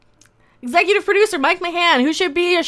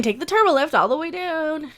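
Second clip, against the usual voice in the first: usual speaking voice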